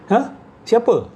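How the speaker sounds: normal talking voice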